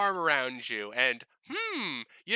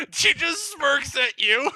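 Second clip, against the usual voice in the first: loud, high, drawn-out burst through laughter; laughing narration; 11 semitones higher, 6 dB louder, slower than usual. Real, not acted. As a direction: crack up while delivering it, pitch jumping. Game master laughs while narrating.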